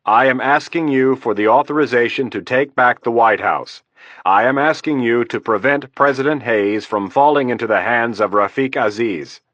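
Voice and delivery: firm, insistent general pressing for authorization. Acted. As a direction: lean in, steady and firm. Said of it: "In a firm voice".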